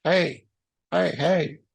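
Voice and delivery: high, restrained re-enacted call. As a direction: call over to passers-by for attention. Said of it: "I started calling to get their attention".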